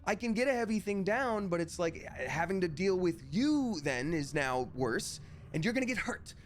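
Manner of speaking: high, strained retold rant; exasperated anger. Daughter to mom hovering behind the heavy load, exasperated rant. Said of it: "I'm sorry, I'm angry".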